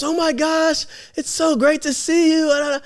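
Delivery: high-pitched, drawn-out mock delight; gushing warm welcome. Teacher greeting favourite former students, gushing warmly. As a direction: imitate an over-sweet, thrilled greeting.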